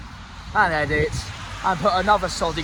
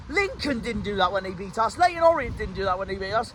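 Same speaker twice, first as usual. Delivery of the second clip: ordinary talk, nothing marked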